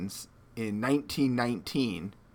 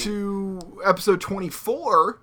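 announcer; 6 semitones higher, 6 dB louder than usual. Acted. Opening the podcast episode.

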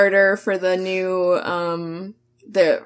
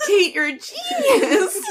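laughing. Real, not acted. Laughing praise.

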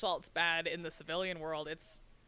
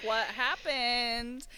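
mock wife question; 4 semitones higher, 5 dB louder, much slower than usual.

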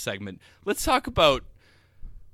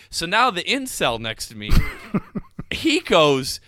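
ordinary talk, nothing marked

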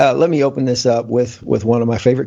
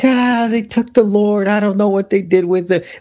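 distraught Mary; 8 semitones higher than usual. Retold or imitated. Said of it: "She's like".

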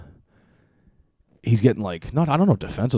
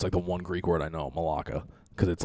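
ordinary talk

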